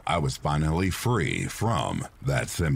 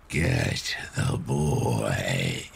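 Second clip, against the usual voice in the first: low, very slow ghostly rasp; breathy rasp; 6 semitones lower, much slower than usual. A narrator's reading. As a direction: stretch it out, ghostly and raspy. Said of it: "It was much louder this time".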